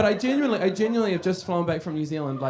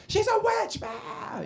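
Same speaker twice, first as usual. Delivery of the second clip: put-on much higher scared kid shout; children yelling at the old lady, mock fright; kids' mock scream; much slower than usual. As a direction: shriek like a spooked five-year-old. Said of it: "We'd be like".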